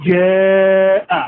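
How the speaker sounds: জাআ with hard j and held vowel; firm jim, long vowel. Shown as a demonstration. Teacher showing hard jim on জাআ. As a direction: stress the j, then stretch. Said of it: "শক্তভাবে আদায় হবে".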